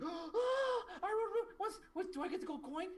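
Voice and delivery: put-on very high, loud squealing child; thrilled little girl. Little girl asking for the gold coin, overexcited. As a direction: gasp and squeal, tripping over the question. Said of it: "And the girl's like".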